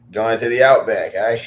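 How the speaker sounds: a bad try at an Australian accent; Aussie accent that doesn't land; Australian accent attempt. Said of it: "I can't do the accent".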